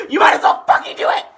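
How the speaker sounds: high, full-voiced shout. Real, not acted. Pushing viewers to subscribe, shouted. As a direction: yell it at the top of your voice.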